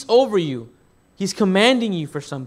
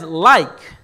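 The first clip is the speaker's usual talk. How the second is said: like said louder, falling; like pointed out with weight; stress on like; 7 dB louder than usual. Shown as a demonstration. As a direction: hit the word like. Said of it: "The reason he says".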